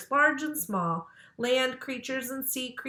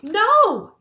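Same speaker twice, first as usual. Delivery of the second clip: high, loud emphatic no; loud refusal; 11 semitones higher, 12 dB louder than usual. A narrator's reading. Girls refusing to look at the snake, a loud high no. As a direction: refuse it flat out.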